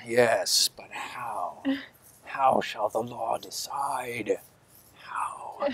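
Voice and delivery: slow, breathless whisper of a zealot; fanatic whispering. Acted. Fanatic asks himself how the law will decide, whispering. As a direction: whisper the question to yourself.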